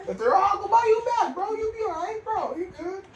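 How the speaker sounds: high-voiced mock reassurance. Mocking tone to picky eaters.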